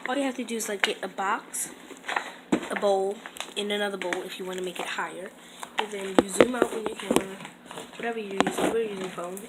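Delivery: ordinary talk, nothing marked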